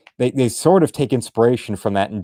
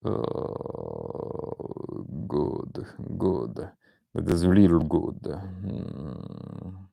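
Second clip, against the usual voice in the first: mock sultry purr; 8 dB quieter, much slower than usual. Returns to Hydra voice.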